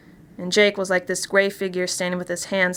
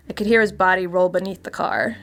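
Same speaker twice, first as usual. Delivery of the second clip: plain reading voice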